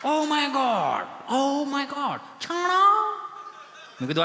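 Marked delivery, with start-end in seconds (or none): 0.0-3.2 higher, drawn-out foreign wonder; mock amazement. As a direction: gasp like an amazed tourist. Tourist amazed at dung cakes. (છાણા જોઈને નવાઈ પામેલા પ્રવાસીની નકલ)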